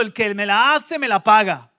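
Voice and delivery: loud, punchy threat; the world's voice swearing revenge; vengeful threat. Acted. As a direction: spit out the grudge.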